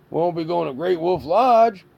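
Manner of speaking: usual speaking voice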